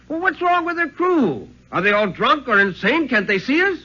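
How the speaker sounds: high, alarmed cry of disbelief. Acted. Keeper cries out at the ship heading for them, alarmed. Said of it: "the Gleo cried out".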